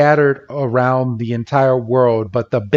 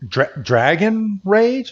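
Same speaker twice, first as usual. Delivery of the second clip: "Dra-, Dragon Rage?" stammered, higher and rising; stunned disbelief; 6 semitones higher than usual. Retold or imitated. Onlookers gasping at Iris's choice of attack, disbelieving. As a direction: stumble on "Dragon Rage" as if you can't believe it. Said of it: "everyone around is like".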